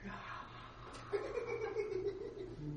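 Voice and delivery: high, jeering crowd laugh; mocking army laughter. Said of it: "I can hear the Philistine army go".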